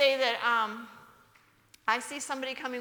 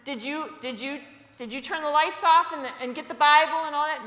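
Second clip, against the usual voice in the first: higher, louder scolding wife; exasperated mother; 4 semitones higher, 7 dB louder than usual. Retold or imitated. Mother questioning father at car, louder scolding. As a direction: scold him, rushing the list. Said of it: "she'd come out the screen door".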